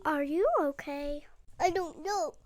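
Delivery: usual speaking voice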